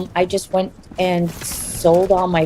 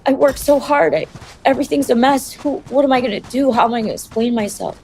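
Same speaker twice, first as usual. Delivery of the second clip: higher-pitched retold plea of despair; despairing protest; 7 semitones higher than usual. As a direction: recount your own helpless outburst, pitch raised. Retelling her own despairing outburst to friend.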